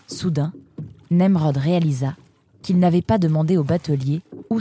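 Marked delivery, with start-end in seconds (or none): none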